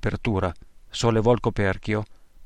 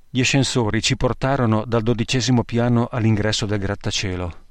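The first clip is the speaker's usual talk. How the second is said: usual speaking voice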